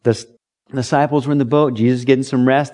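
ordinary talk

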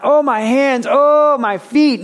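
high mock wail over hands and feet; mock whining complaint. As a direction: ham up a self-pitying moan about the pain.

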